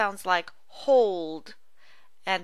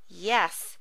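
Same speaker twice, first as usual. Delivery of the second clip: normal talking voice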